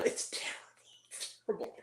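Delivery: whispered in pained disbelief; a hushed, defeated verdict. Real, not acted. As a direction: breathe it out in horror. Aghast verdict on the film.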